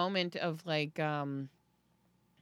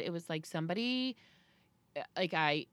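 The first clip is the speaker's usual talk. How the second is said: usual speaking voice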